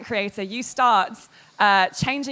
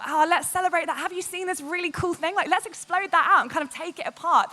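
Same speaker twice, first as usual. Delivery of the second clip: higher, eager enthusiasm; celebratory own voice; 7 semitones higher than usual. Shown as a demonstration. Showing her own style of games coverage, celebratory. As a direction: share a cool find with keen delight. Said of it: "my voice is a lot more".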